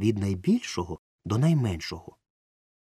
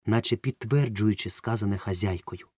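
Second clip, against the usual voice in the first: ordinary delivery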